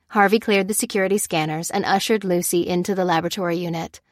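plain reading voice

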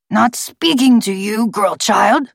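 talking bird's gravelly rebuff; forced gravelly voice, dismissive. A narrator's reading. Bird rebuffing the girl who interrupted, forced gravelly voice. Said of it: "gravelly voice".

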